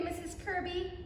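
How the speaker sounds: usual speaking voice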